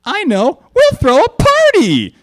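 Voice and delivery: very high, slow, loud squeal of an idea; excited Pinkie. A narrator's reading. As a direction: burst out with the party plan. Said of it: "said Pinkie".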